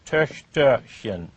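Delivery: "Töchterchen" slowly, syllable by syllable; measured, spaced-out "Töchterchen". Shown as a demonstration. Teacher writing it on the board, slow syllables.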